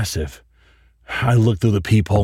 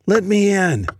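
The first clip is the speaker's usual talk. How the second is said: put-on high, eerie little girl; creepy child voice; 10 semitones higher than usual. Shadow girl asks to come in, high eerie child voice. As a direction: sing it sweetly like a haunting child. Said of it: "a silhouette of a girl".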